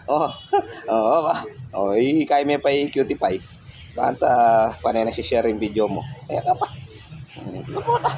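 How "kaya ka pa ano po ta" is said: high coaxing. Real, not acted.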